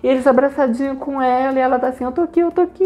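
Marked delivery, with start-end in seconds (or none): 2.0-2.8 high-pitched, relieved repeating; imitated rescued girl; emotional reassurance. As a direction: comfort your friends while overwhelmed.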